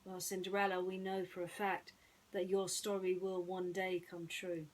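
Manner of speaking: plain reading voice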